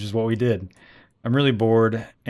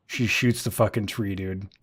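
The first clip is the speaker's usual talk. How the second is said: usual speaking voice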